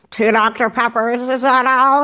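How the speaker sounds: mock drive-thru drone; put-on voice of uninterested staff; imitated bored worker. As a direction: recite it like a fed-up order taker. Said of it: "very, like, monotone".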